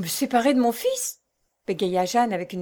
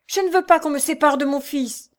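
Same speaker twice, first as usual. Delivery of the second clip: louder, higher resistance; struggling refusal; 6 semitones higher, 5 dB louder than usual. A narrator's reading. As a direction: fight them off with the words. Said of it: "en se débattant". (refus en se débattant)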